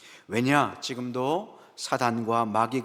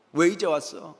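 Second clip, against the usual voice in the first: mock angry scolding, higher pitch; 화내는 점쟁이 흉내; 4 semitones higher, 3 dB louder than usual. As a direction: imitate a fortune-teller telling off a client. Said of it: "막 화를 내요". (점쟁이가 호통치듯 높여 흉내 낸 말투)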